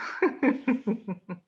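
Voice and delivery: real amused chuckle; a short run of light giggles. Real, not acted. Astrologer amused by Eris manipulating secrets, chuckling.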